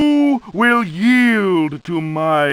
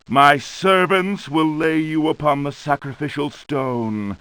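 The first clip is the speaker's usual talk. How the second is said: ordinary delivery, nothing marked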